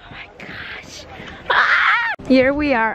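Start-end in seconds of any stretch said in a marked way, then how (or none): 1.5-2.1 high sharp shriek. Real, not acted. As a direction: yelp at the cold. Cold snow shriek.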